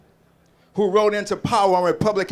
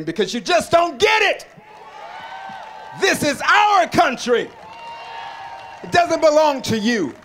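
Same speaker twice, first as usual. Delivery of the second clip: raised, higher rally cry, driven home; shouted defiance at the liberal media; defiant shout; 5 semitones higher than usual. Real, not acted. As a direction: hurl it back at the media.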